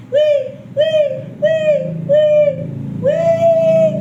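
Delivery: loud, very high repeated "wee"; piercing playful calls; high squeals. Real, not acted. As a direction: squeal out a string of wees.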